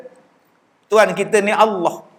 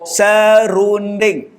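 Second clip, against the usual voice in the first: very slow, spelled out; slow repeat; 3 semitones higher, much slower than usual. Retold or imitated. Repeating "Serunding" for the confused officer, drawn out slowly. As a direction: drag each syllable of "Serunding".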